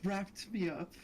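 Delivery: usual speaking voice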